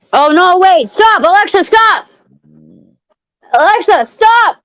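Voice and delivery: high, urgent calls to stop; panicked commands. Real, not acted. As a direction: stop the speaker in a rush. Panicked command to smart speaker.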